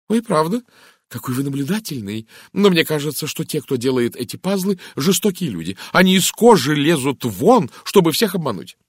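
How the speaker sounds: animated, high-voiced lady; chatty American lady, amazed then indignant. A narrator's reading. Narrator voices talkative Mrs Gardner.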